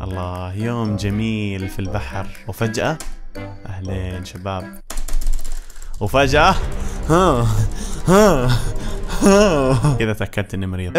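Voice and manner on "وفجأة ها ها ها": startled gasps. Real, not acted. Sudden scare in the game.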